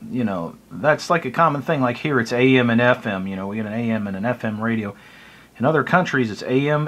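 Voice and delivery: ordinary talk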